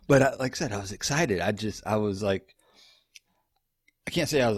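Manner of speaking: usual speaking voice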